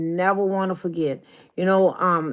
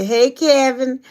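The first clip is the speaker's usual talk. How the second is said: higher, louder hello to Kevin; bright greeting; 7 semitones higher, 6 dB louder, slower than usual. Acted. Passing on a viewer's hello to Kevin, high and loud. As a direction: call out a high, loud hey.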